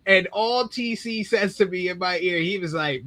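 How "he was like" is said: ordinary talk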